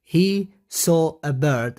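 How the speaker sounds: slow, word-by-word reading. Shown as a demonstration. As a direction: read it out slowly for learners. Teacher reads sentence slowly, then repeats.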